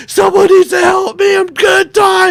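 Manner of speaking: high, loud panicked yell; panicked patient yelling at hospital staff; panicked shout. Retold or imitated. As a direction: scream it in full panic. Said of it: "I'm like".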